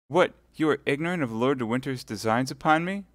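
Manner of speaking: high, pleading martyr; wounded innocence. Acted. Milady pleading her innocence to Felton. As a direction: protest innocence with a smile.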